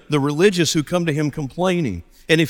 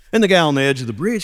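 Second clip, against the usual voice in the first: ordinary delivery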